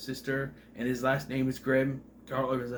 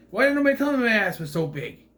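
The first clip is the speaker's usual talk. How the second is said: higher, louder, fast mock whine; a high, quick, outraged complaint about a big behind; Spaceballs quote; 8 semitones higher, 5 dB louder, faster than usual. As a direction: squeal it out in a hammed-up Spaceballs voice. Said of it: "that sounds really like S-, Spaceballs".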